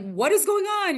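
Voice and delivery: high shocked question; parents' shocked question. Retold or imitated. Parents bursting in, high and alarmed. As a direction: pitch way up in alarm. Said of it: "my parents were like".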